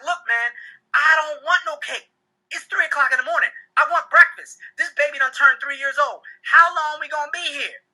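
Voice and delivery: high-pitched, fed-up complaining; exasperated protest. Acted. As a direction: plead your case like a worn-out guest. Acted fed-up guest arguing with host.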